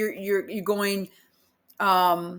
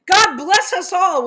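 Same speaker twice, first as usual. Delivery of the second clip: louder, mock-emphatic blessing; mock exclamation; 7 semitones higher, 7 dB louder than usual. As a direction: shout it like the exclamation marks. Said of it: "with multiple exclamation points".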